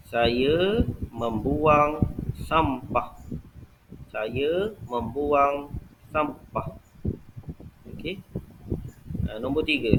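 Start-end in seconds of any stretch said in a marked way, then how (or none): 0.1-3.1 very slow dictation, word by word; very slow example sentence. Shown as a demonstration. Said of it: "nombor dua, buang". 4.1-6.7 very slow, softer dictation, word by word; very slow example sentence. Shown as a demonstration. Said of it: "nombor dua, buang".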